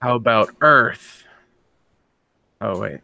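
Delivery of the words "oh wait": usual speaking voice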